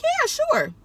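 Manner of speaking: very high, polite little reply; put-on high-pitched agreeable voice; acted polite agreement. Driver agreeing to see a passenger's pictures, high polite agreement.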